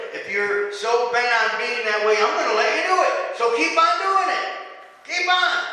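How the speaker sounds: mock permission climbing high and loud; sarcastic. Preacher voices God leaving stubborn people to it, sarcastically. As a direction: sarcastically wave them on, building in pitch and volume. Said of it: "That was sarcasm".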